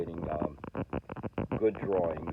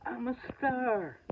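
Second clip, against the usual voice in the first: high, put-on voice, slow then louder, a hammed-up starlet; mock diva; 4 dB louder, slower than usual. Vlogger crowning himself a star over seven views, mock grand. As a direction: play a self-crowned star, tongue in cheek.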